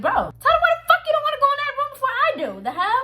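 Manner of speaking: high, loud, rapid outburst; exasperated shouting. Retold or imitated. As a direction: yell at a friend to speak up. Retells yelling at the silent girl.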